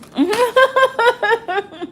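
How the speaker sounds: loud high-pitched burst of laughter; loud delighted cackle. Real, not acted. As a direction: let out a big squealing laugh. Big laugh after junk food.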